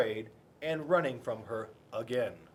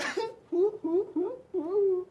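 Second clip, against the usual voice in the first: teary giggle; 4 dB louder than usual. A narrator's reading. Penelope giggles tearfully.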